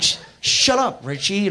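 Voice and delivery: high, loud, drawn-out shut-down; mock-annoyed yell at a heckling pal; retold shushing shout. Retold telling friend to shut up.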